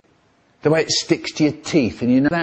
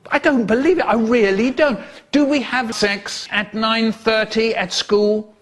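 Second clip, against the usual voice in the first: high-pitched, incredulous telling-off; exasperated scolding; 7 semitones higher than usual. Acted. Teacher scolding a class at school, exasperated.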